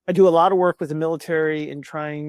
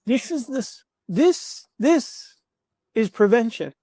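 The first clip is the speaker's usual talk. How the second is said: emotional climax; 6 semitones higher, 4 dB louder, slower than usual. Real, not acted.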